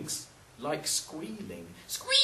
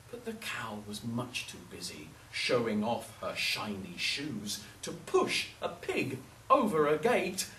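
usual speaking voice

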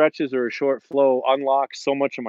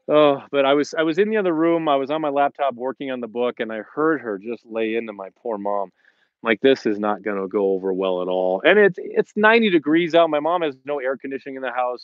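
usual speaking voice

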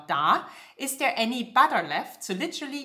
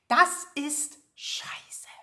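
whisper; 5 semitones higher, much slower than usual. Shown as a demonstration.

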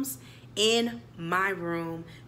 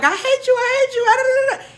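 high loud mock yelling; hammed-up shouted I hate you; mock couple fight; 8 dB louder than usual. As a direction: shriek it like a bickering girlfriend. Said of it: "Y'all be like".

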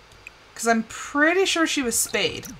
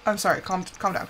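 normal talking voice

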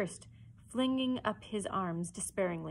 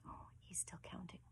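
real whisper, very soft; whispered aside; 20 dB quieter than usual. Real, not acted. Reader remarks on Slightly's counting, whispered. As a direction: whisper a comment on the story.

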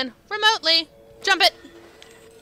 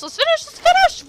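excited urging; 7 dB louder than usual. Real, not acted. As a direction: pitch climbing higher and louder each time. Urging the run to end.

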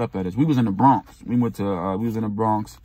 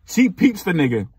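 ordinary talk

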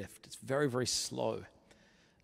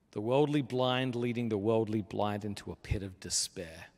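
normal talking voice

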